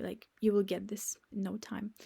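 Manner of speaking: soft unvoiced reassurance; gentle encouraging whisper. Real, not acted. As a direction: whisper it kindly to the learners. Reassuring learners.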